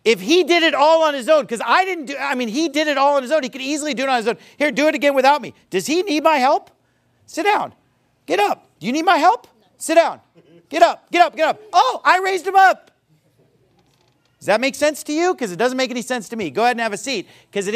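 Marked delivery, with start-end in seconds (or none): 4.6-11.6 high, emphatic rapid-fire orders; demonstrative commands. Acted. Ordering volunteer up and down. 11.7-12.9 high, loud feigned astonishment; mock amazement. Mock surprise at his own feat.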